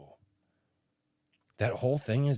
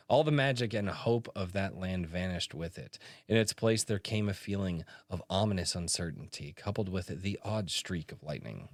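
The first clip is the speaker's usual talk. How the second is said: lower, measured storytelling read; dramatic reading; 4 semitones lower than usual. Acted. Reading the author's first page aloud as a dramatic narrator. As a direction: read it like an epic opening.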